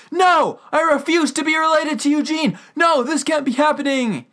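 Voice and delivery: high, loud yell of refusal; overdone retold freak-out. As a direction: shout in hammed-up horror and denial. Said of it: "then Bernard freaks out".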